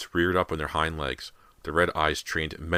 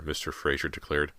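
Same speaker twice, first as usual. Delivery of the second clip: usual speaking voice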